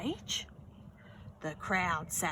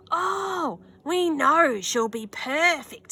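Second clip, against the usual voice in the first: slow, higher, louder assurance; confident man; 8 semitones higher, 6 dB louder, slower than usual. Acted. A man in the crowd praises Beatrice, slow and sure. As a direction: sure of her perfection. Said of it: "said a man".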